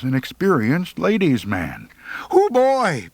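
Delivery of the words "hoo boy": put-on high whooping hoo boy; excited hoot. A narrator's reading. Birdie hooting as they drive up, excited. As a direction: hoot it out with glee.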